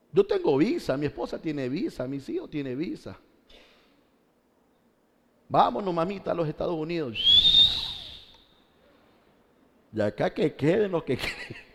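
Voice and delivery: lightly mocking, singsong swoops in pitch; mock eager emigrant. Pastor mimics a man taking his family to the States, lightly mocking. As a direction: play the carefree leaver, breaking into a laugh at the end. Acting out those who would flee.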